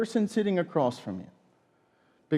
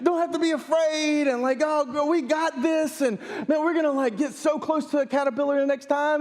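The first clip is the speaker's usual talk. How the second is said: hyped pep talk; 9 semitones higher than usual. Retold or imitated. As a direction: pump her up like a coach before the game. Dad's pep talk retold.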